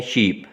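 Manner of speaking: शीप with the ee held long, slow; long vowel sheep. Shown as a demonstration. Teacher repeats the long शीप for students. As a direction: draw out शीप slowly. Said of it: "ये long".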